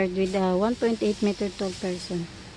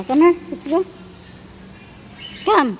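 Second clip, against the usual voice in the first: loud, higher summons; brisk raised-voice call; 6 semitones higher, 6 dB louder than usual. Real, not acted. Calling child to move on.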